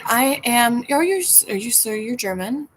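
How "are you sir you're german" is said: normal talking voice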